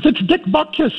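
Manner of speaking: high starstruck lady voice; excited fan lady. Retold or imitated. Older lady mistaking the coach, thrilled. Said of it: "she goes".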